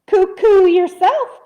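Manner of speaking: ordinary delivery, nothing marked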